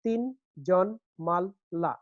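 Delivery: "তিনজন মাল্লা" slowly, as four beats; syllable by syllable. Shown as a demonstration. Teacher showing the next foot, each syllable apart. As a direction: count out তিন, জন, মাল, লা. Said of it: "একটা পর্ব".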